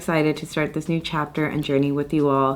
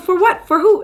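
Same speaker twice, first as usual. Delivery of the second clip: much higher, louder imitation of dad asking; mock dad, baffled; 5 dB louder than usual. Vlogger imitating dad not grasping her celebration, high mock voice. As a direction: play a puzzled father pushing back.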